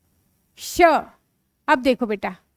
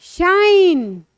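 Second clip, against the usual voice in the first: slow blending; much slower than usual. Shown as a demonstration. Teacher blends sounds into word.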